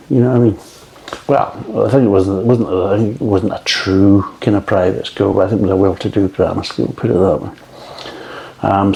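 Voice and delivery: ordinary delivery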